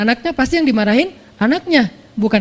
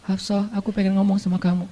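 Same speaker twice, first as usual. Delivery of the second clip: much softer, gentle and intimate; tender; 2 semitones lower, 7 dB quieter than usual. Acted. Husband gently asks his wife to talk, soft and tender. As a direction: speak to her tenderly. Prophet tenderly addressing Hafsah.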